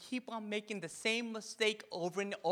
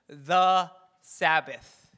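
"the Sabbath" said very slowly and louder; drawn out emphasis; 8 dB louder, much slower than usual. Shown as a demonstration. Pastor stresses "the Sabbath" to the congregation, slow and louder. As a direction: drag out "the Sabbath" for weight.

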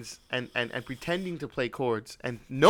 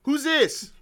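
loud, high annoyed demand; annoyed at an unknown caller, loud and high; irritated who's this; 13 semitones higher, 8 dB louder than usual. Retold or imitated. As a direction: snap it at the caller. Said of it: "I'm all pissed off".

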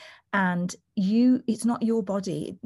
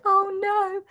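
high, loud mock dismay; a squeal of mock panic; mock alarm; 13 semitones higher, 4 dB louder than usual. Doula reacting to the client's plea, mock alarm. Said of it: "I was like".

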